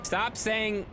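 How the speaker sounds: high, loud cry to stop; high exasperated call; exasperated yell. Real, not acted. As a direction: cut chat off sharply. Streamer scolding chat.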